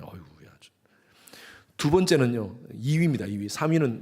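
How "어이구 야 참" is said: whisper. Real, not acted. Muttered sigh after no response.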